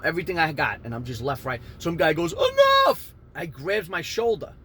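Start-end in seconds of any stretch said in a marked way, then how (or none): 2.3-3.1 very high, loud yell; mock shouted intervention. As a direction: yell it to break up a fight. Bystander breaks up the fight.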